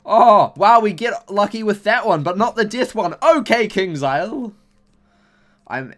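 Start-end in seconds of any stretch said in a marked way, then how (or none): 0.1-4.5 high, loud burst of excitement turning to protest; excited outburst. Real, not acted. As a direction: yelp it out over the pack results. Lucky pack but missing spell.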